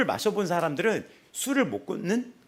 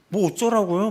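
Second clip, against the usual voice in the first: loud, higher talk-back; defiant retort; 6 semitones higher, 7 dB louder than usual. Retold or imitated. Woman refusing to move her seat, loud defiant talk-back. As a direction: throw it at the passengers. Woman defies the bus passengers.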